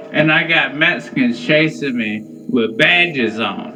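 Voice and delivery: ordinary talk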